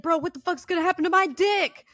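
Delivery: high, loud, fast mock panic; freaking out. Honey-pack eater asking roommate about consequences, hammed-up freak-out. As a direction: blurt it like a panicked patient. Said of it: "he started freaking out".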